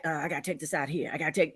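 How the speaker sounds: low quick hushed excuse; sneaky mumble. Retold or imitated. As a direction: mumble it like a guilty cheater. Imitating a shady partner on phone.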